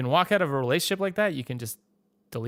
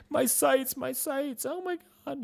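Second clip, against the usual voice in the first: mock breakdown; slower than usual. Answering friends who ask what's wrong with him, a hammed-up mock sob. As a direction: whimper it like a child mourning a lost toy. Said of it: "I just sort of broke down".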